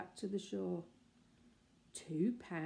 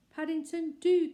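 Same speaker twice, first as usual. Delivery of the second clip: raised motherly call across the sand; 10 semitones higher, 10 dB louder than usual. Acted. Mrs Brown calls Paddington back for his suitcase, raised call. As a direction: call after him to stop. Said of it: "called Missus Brown".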